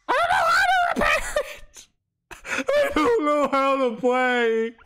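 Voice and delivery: high whining wail; whiny crying. Real, not acted. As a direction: wail in frustration. Frustrated whining.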